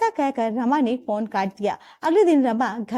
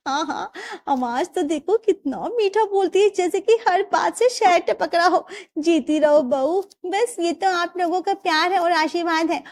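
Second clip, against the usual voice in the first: lightly voiced chatty aunties, a bit higher; gushing praise in a lightly put-on voice; sweet village women; 7 semitones higher than usual. Aunties praising the sweet daughter-in-law, lightly voiced.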